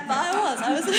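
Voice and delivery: words breaking into laughter; laughing. Real, not acted. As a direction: still laughing, pitch up. Still laughing.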